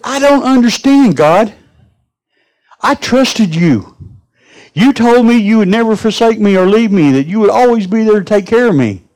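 hammed-up outrage, a drowned lady scolding God; mad at God. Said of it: "She was mad".

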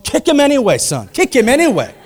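mock-eager dad urging his boy on; egging son on. Dad egging his little son on to kick, playful. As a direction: talk your kid into it, half laughing.

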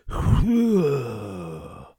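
loud, drawn-out mock spit noise; imitated spitting. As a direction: act out a big spit with a falling whoosh. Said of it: "she spits on my head".

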